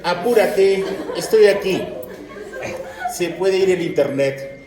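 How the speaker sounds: loud, laughing teasing call to hurry; a playful, laughing "come on already"; laughing mock come-on. Teacher joking to students, loud laughing mock summons. Woman's foot signals, joking.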